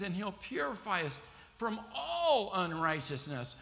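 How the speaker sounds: high and loud, every word hammered; preacher repeating the verse, fired up; preached proclamation. Shown as a demonstration. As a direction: proclaim it to the whole church. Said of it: "And he will what?".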